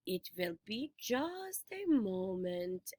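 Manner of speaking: put-on slow, soft old lady voice; a sweet old woman stalling; Auntie Em. A narrator's reading. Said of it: "Auntie Em said".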